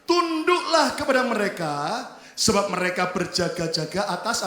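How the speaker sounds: loud, measured recitation; unison scripture reading. Real, not acted. Preacher reading the verse with the congregation, loud recitation. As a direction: lead the whole room in reading aloud.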